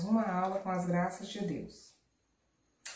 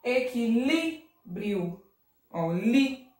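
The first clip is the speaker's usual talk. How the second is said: Equilíbrio slowly, LÍ drawn out; stress on LÍ; much slower than usual. Shown as a demonstration. Teacher isolating the strong syllable, long LÍ in Equilíbrio. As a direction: stretch LÍ in Equilíbrio, then repeat it. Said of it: "acento agudo".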